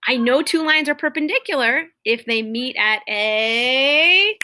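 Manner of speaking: slow rising trail off; trail off fill-in. Shown as a demonstration. Teacher leaving a blank for students, slow rising. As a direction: let the sentence hang for the class. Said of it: "my fill-in".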